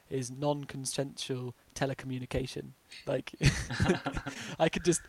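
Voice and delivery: normal talking voice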